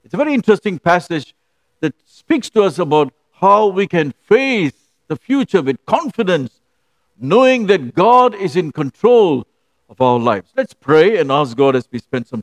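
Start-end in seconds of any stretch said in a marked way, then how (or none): none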